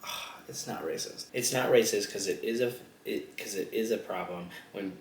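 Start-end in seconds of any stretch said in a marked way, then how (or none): 0.0-1.2 breathy whispered aside; whispered quote. Retold or imitated. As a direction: drop to a whisper. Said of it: "he was like".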